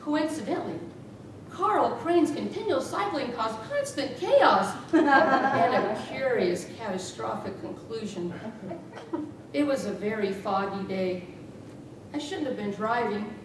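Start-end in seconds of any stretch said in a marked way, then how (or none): none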